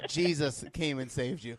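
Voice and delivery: laughing loudly through the joke, slow; laughing punchline. Real, not acted. Joking to friends about the helper, laughing loudly. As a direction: crack up while delivering it.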